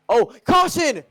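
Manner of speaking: high, loud sudden cry; excited shout. Real, not acted. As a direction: yell out the caution. Yellow flag, sudden crash.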